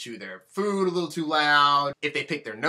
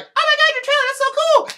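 put-on much higher, giddy girly voice; mock gushing girl; 6 dB louder than usual. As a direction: ham up a starstruck actress. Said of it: "she was like".

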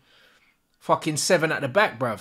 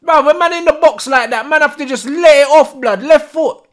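much louder, higher angry shouting; furious yell; 8 semitones higher, 10 dB louder than usual. Real, not acted. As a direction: scream at Bruno for passing. Frustrated at chance.

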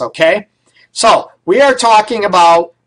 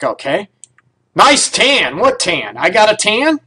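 ordinary talk, nothing marked

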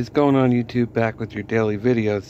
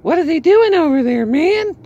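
much higher, louder mocking voice; imitating Tony Khan; 4 dB louder than usual. Vlogger imitating Tony Khan, high and loud mocking. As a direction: squeak it out as a baffled, annoyed boss. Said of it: "scratching his head like".

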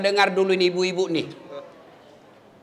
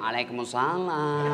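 softer, slower reply, drawn out sweetly; swoony reply; 6 semitones lower, 8 dB quieter, slower than usual. Retold or imitated. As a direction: melt into the answer. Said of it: "mendayu-dayu mensahdukan suaramu".

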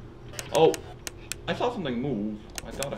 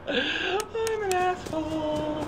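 laughing; 13 semitones higher, much slower than usual. Real, not acted. Laughing after scaring friend.

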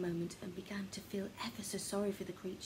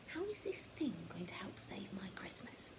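whispered, much softer; inner thought; 8 dB quieter than usual. Acted. Oliver wondering silently about the creature, whispered. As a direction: think it under your breath. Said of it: "Oliver thought to himself".

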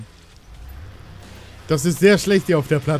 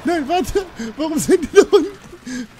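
high-pitched alarm; panicked yelp; 13 semitones higher, 4 dB louder, slower than usual. Real, not acted. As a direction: cry out in sudden game panic. Game panic as enemies get through. (panischer, hoher Aufschrei)

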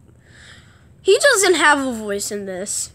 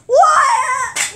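high, loud cry of disbelief; wailed high-pitched outburst; shocked yelp; 12 semitones higher, 5 dB louder than usual. Pretend. Toy flew away, mock disbelief.

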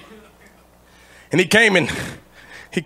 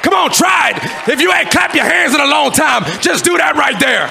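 full-force preaching; 12 semitones higher than usual. Real, not acted. Preacher urging the congregation to praise, full-force shouted preaching. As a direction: shout it out to fire up the church.

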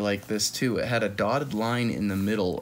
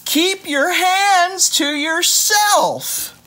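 high, loud, slow scolding; teacher's shout; 9 dB louder, much slower than usual. Acted. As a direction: drag out tardy like a cross teacher. Teacher scolding in read book.

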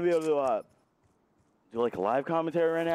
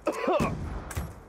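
hard coughing fit; 10 semitones higher than usual. Real, not acted. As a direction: cough from spicy heat. Coughing from spicy food.

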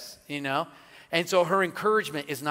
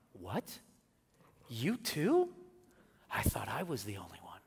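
hushed discovery; 6 semitones lower, 7 dB quieter than usual. Retold or imitated. Quoting friendship's discovery.

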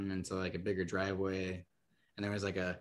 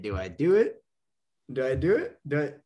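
louder, higher mock-anxious "do I do it?"; excited self-dare; 6 semitones higher, 9 dB louder than usual. Hesitating at the driveway, loud excited self-questioning. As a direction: psych yourself up out loud. Inner debate, daring himself.

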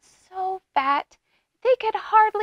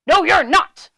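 loud, raised troll refusal; menacing troll; 5 semitones higher, 6 dB louder than usual. A narrator's reading. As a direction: cut the goat off as the troll. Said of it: "said the troll".

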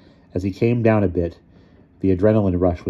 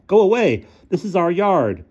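put-on high, loud boyish yell; failed tough act; 12 semitones higher, 5 dB louder than usual. A narrator's reading. Ludwig tries to chase off the figure, scared bluster. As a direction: shout it with false bravado. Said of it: "failing to sound tough".

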